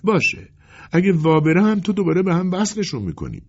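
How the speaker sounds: high, insistent customer; pleading customer. Acted. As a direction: press the tailor hopefully, voice high.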